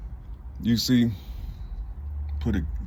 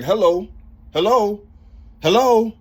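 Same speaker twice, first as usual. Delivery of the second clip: imitating callers, each hello higher and louder; caller on phone; 9 semitones higher, 6 dB louder than usual. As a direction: call hello into a dead line, rising. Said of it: "I can hear them saying".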